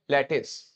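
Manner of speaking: लैटिस said as LET-iss; correct pronunciation. Shown as a demonstration. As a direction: teach लैटिस with a short 'iss' ending. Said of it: "इसको बोलेंगे lettuce". (लैटिस का सही उच्चारण, 'लैट-इस')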